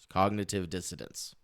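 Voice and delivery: slurred as if with marbles in mouth; thick, mumbled words. Real, not acted. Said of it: "Excuse me for the marbles in my mouth".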